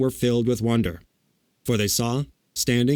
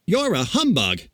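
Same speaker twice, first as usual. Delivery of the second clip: higher, mildly hurt accusation; grieved; 7 semitones higher than usual. A narrator's reading. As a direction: accuse him with a touch of grief. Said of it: "said the Scarecrow in a grieved tone".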